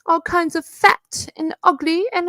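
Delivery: a mocking high whine; mocking whiny imitation. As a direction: mimic her whining to Sam. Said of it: "she makes me so fucking sick".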